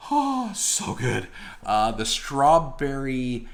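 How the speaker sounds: high, slow, savoring delight. Real, not acted. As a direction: swoon over the taste. Tasting a Kit Kat.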